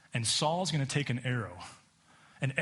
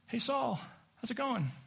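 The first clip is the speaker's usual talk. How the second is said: high, casual and offhand; a jaunty hey to an old enemy; breezy greeting; 9 semitones higher, 3 dB quieter than usual. Retold or imitated. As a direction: call it out like nothing happened. David calling casually from cave.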